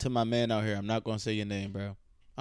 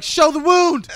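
loud, higher heckle from the back; heckling shout; 11 semitones higher, 13 dB louder, much slower than usual. Retold or imitated. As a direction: yell it out at the stage. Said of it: "be a dick from the back".

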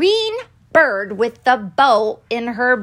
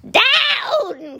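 very high, stretched-out cry; witch's cry; 13 semitones higher than usual. A narrator's reading. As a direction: wail the order as the witch. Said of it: "cried the witch".

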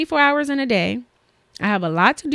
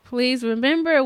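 ordinary delivery

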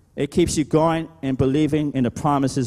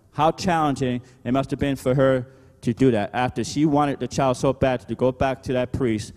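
ordinary talk, nothing marked

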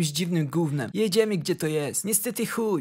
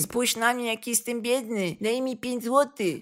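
mock beggar whine; 4 dB quieter than usual. A lightly voiced poor relative begs the narrator for money. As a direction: plead pitifully, mocking them. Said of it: "Wkurwiali mnie ze swoim".

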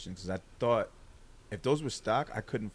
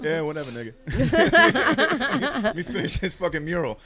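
high, loud quote broken by laughter; laughing dismissive painter; 11 semitones higher, 15 dB louder than usual. Retold or imitated. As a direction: brush him off while cracking up. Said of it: "And they're like".

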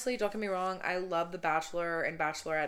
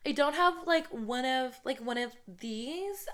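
pitched up, lighter voice; high-pitched voice; 6 semitones higher than usual. Shown as a demonstration. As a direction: put on a higher voice than your own. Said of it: "a little bit of a deeper voice".

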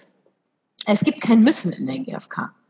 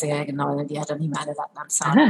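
scoffing listeners; 7 semitones lower, 4 dB quieter than usual. Retold or imitated. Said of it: "so viele sagen jetzt".

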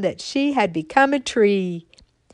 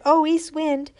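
acorn calling to the wind; 6 semitones higher, 4 dB louder than usual. Acted. Little acorn calling up to the north wind, pleading. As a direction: call up hopefully to the wind. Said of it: "she said".

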